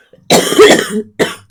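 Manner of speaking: a loud hacking cough fit; real harsh cough. Real, not acted. Sick vlogger coughing mid-chat, real harsh cough. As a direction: cough hard, chest rasping.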